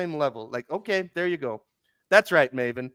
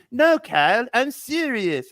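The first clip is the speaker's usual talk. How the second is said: high, whiny imitation with falling swoops; Cartman; 8 semitones higher, 3 dB louder, slower than usual. Cartman insisting to Kyle that he means it, high and whiny. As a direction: plead innocence like a sneaky kid. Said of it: "Cartman is a sneaky bastard".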